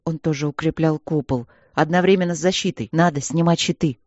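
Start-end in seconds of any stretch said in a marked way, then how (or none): none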